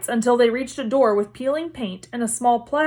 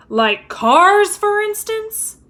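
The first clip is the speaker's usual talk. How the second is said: sharp, rising, slowed challenge; pointed question; 11 semitones higher, 6 dB louder, much slower than usual. Acted. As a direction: catch him out with it. Mrs Weasley appears, pointed.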